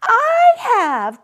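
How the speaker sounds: high, loud protesting cry. A narrator's reading. Little rabbit crying out against the taunt, high and loud. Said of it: "cried the little rabbit".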